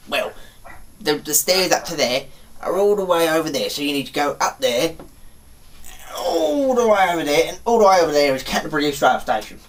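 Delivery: hammed-up bloke with stretched vowels; put-on local bloke. Bloke directing a stranger far away, hammed-up voice.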